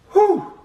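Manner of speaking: high burning whew; sharp exhale. Real, not acted. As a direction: blow out from the heat. Reacting to hot sauce.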